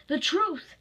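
sharp shouted demand; angry yell. Acted. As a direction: yell it as an order. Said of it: "he yelled at her".

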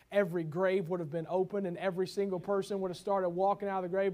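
ordinary talk, nothing marked

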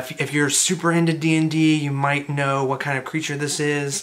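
usual speaking voice

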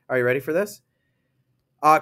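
ordinary talk, nothing marked

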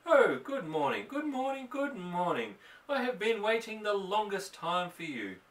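high, sweet cat voice; the cat's voice. Acted. Mother voicing the cat's good-morning, high and sweet. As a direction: coo it like a cat greeting her. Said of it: "She can say".

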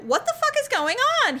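high-pitched mock alarm; retold bewilderment. Woman retelling arriving at his cabin, bewildered. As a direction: exclaim it high, utterly baffled.

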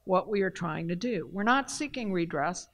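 ordinary talk

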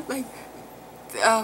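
ordinary talk, nothing marked

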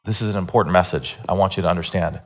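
ordinary talk

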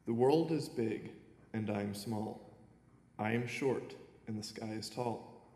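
ordinary talk, nothing marked

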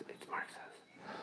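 hushed conspiratorial aside; whisper. Pretend. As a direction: whisper it like a secret. Secret-bunker joke, whispered aside.

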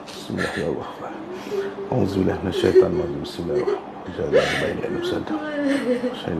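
murmured prayer. Real, not acted. Healer murmuring prayers over the possessed patient. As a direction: murmur the verses under the breath.

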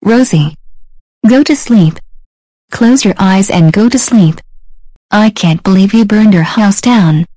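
loud furious scolding; angry yelling. Acted. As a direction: bellow at her to sleep. Parent furious at bedtime.

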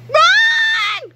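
high, loud yell; shouted command. Acted. George yelling at Harold to flee, high loud shout. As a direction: scream it to escape. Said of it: "cried George".

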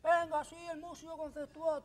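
high rowdy chant; crowd shouting. Retold or imitated. Guests shouting across the tables, high rowdy chant. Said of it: "todo el mundo grita".

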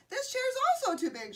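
high, loud, rapid storybook shout; shouted complaint. A narrator's reading. Goldilocks rejects the second chair, shouted high and fast. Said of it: "shouted Goldilocks".

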